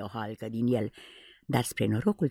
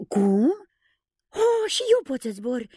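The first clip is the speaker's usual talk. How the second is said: loud, much higher cry of amazement; astonished shout; 11 semitones higher, 4 dB louder, slower than usual. Acted. John shouting to the others, amazed he flies too. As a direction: caught off guard by his own flying. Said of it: "strigă uimit John".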